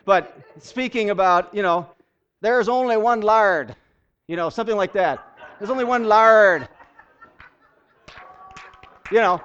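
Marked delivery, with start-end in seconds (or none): 2.4-3.8 put-on loud, high Newfie accent, drawn out; Newfoundland accent. Preacher imitates a Galilean accent for the congregation, Newfoundland accent. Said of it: "like a Newfie accent or something". 5.6-6.7 put-on very loud, high Newfie accent; Newfoundland accent. Preacher repeats his joke about how Galileans sound, Newfoundland accent. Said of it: "like a Newfie accent or something".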